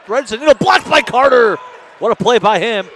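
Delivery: rising play-by-play yell; excited shout. Real, not acted. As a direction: call the block at full roar. Big block in live call.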